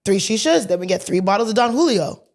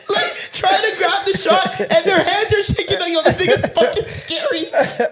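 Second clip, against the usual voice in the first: high-pitched, real laughter through the words; laughing while telling; 8 semitones higher than usual. Real, not acted. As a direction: tell it cracking up, voice pitched up. Laughs retelling friends' shaking hands.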